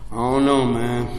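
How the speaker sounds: low, subdued doubtful addict. Acted. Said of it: "You know what the addict said".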